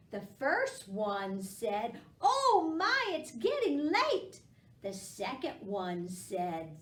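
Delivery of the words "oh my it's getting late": squeaky high worried pumpkin. Acted. Teacher voicing a pumpkin for kids, high and loud. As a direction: pitch way up like a tiny pumpkin.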